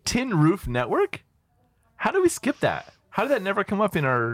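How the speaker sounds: high, incredulous disbelief; can't believe this was overlooked, pitch shooting up; astonished. Real, not acted. Host finding the perfect name is available, astonished.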